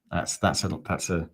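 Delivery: usual speaking voice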